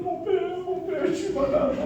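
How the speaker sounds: high, soft plea to father; a son frightened, calling his father; distressed son. Retold or imitated. Son telling his father he is sick, high and distressed.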